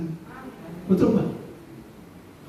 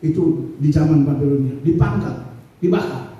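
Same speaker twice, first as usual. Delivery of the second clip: normal talking voice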